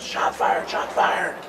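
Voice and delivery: normal talking voice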